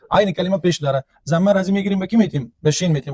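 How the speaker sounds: ordinary talk, nothing marked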